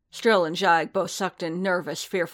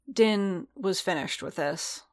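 plain reading voice

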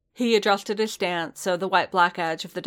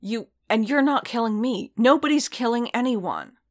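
higher, louder, insistent; exasperated protest; 6 semitones higher, 4 dB louder than usual. A narrator's reading. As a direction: argue back in heated exasperation. Heated quarrel during a fight.

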